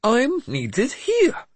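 very high, drawn-out indignant protest. A narrator's reading. Mad bishop refuses to be sent home, indignant. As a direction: protest slowly, pitched right up, affronted. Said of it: "Jeremias said indignantly".

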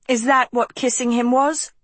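mock him with it. A narrator's reading. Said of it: "Agatha mocked".

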